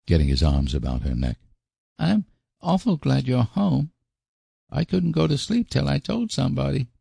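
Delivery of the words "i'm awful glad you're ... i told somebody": put-on high-pitched child's voice, unburdening himself; high little-boy voice, confiding; Davy, upset little boy. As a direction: play a troubled small boy, pitched high. Reader voices the small boy Davy.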